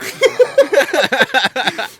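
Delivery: high, loud burst of laughter. Real, not acted. Laughing after own punchline.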